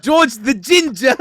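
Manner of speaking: mock grand title. Real, not acted. Friend dubbing Washington a nickname, slow mock announcement. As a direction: crown him with a mock title.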